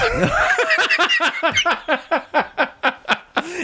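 long high rising laugh. Real, not acted. Laughing at Starbucks as the food, long and high. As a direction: laugh hard and climb up.

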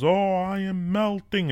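high, slow, drawn-out cry of a melting witch; a retold witch's slow high lament. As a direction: wail it like the witch dissolving. Witch melting after water thrown.

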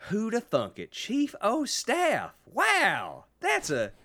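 high, drawn-out dim-witted Southern wonder; mock amazement. Host imitates politician discovering basic things, in mock amazement. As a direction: marvel slowly, hammed up, at the obvious. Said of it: "being amazed at how basic things work".

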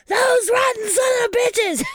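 high, loud outraged yell; screamed curse. Retold or imitated. Podcaster retelling an outburst outside after the episode, screamed curse. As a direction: re-enact screaming curses at the heavens. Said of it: "did you scream to the heavens".

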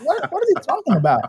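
baffled. Retold or imitated. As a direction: laugh out your confusion at the coach. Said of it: "I was like".

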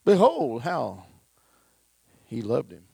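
loud rising declaration; proclaiming. Acted. Preacher proclaims the verse to the congregation, loud. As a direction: cry it out to the church.

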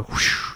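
breathy whoosh of a passing dart; whoosh sound effect. Pretend. Game master narrating a missed dart throw, whoosh sound. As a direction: imitate a dart whizzing by.

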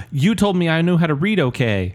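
put-on high-pitched childlike excitement; starstruck kid. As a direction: gush like a former pupil.

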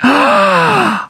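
a sudden high gasp of waking realization; startled gasp. Retold or imitated. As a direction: gasp as if surfacing from a fog. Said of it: "woke up off the couch and went".

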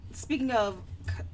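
ordinary talk, nothing marked

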